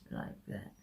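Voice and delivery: breathy whisper under her breath; whispered focus; whispered aside. Real, not acted. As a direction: murmur it to yourself as you work. Concentrating while applying makeup.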